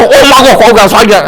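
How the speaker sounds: mock hurt complaint. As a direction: imitate an offended wife accusing him. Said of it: "Kalau kata".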